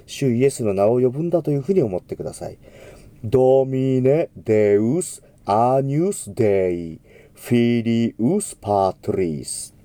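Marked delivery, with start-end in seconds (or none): 3.2-9.7 slow, careful Latin; slow model diction. Shown as a demonstration. Teacher modelling Agnus Dei reverently. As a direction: say アニュスデイ slowly as calling Jesus' name. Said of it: "アニュスデイという言葉を言うときには".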